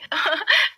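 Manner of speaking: high nervous giggle rising up; nervous laugh. Acted. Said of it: "घबरा जाती है और हकलाने लगती है".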